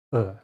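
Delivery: short relaxed uh as a schwa; isolated schwa; schwa sound. Shown as a demonstration. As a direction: demonstrate the neutral uh. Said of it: "will frequently make the sound".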